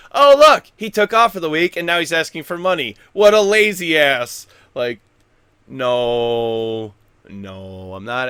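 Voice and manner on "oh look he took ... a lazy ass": mocking critic. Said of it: "digging really hard to be like".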